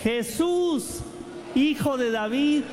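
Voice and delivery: high pleading chant, slowing on the plea; leading a cry. Shown as a demonstration. Preacher leading the congregation in the blind man's cry, high pleading. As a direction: lead the crowd in a cry for mercy. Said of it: "digamos todos".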